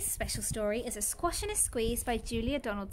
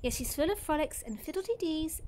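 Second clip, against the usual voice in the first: ordinary delivery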